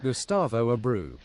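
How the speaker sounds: usual speaking voice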